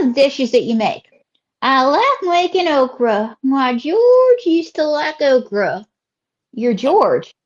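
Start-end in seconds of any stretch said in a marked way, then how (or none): 1.6-5.8 swooping Southern drawl; Southern lady character. Acted. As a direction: stretch the vowels like a chatty Southern belle. Host voices Ruby.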